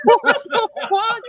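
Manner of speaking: high, slow, laughing shock; laughing in disbelief. Real, not acted. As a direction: say it through a laugh. Shocked at the mix-up.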